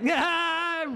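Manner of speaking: high, loud manic cackle; mad high laugh. Acted. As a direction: laugh like a madman behind the wheel. Jake laughing madly.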